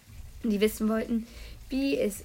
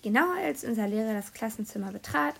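ordinary delivery

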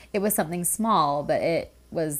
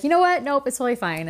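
bright reassurance; 7 semitones higher, faster than usual. Retold or imitated. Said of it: "And I was like".

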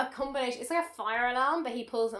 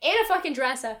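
higher and louder, incredulous fury; outraged rant; 5 semitones higher, 4 dB louder than usual. Real, not acted. Ranting to her co-host about the heroine, higher and louder. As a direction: tear into the plot.

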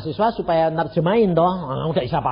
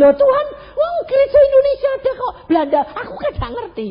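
much higher, fast, loud complaining; retold protest to God; 8 dB louder, faster than usual. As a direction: whine back at God, half laughing. Protes kepada Tuhan. (protes kepada Tuhan, tinggi dan cepat)

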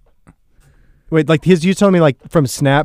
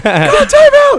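much higher, louder desperate yell; yelled plea; 5 dB louder, much slower than usual. Retold or imitated. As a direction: scream at the coach to stop the clock. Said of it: "'Cause I was yelling".

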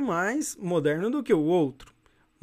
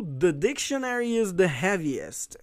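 slow model reading. Shown as a demonstration. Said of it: "Olha só as frases aí pra você treinar".

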